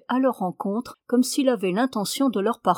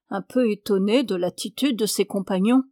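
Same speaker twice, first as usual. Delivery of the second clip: plain reading voice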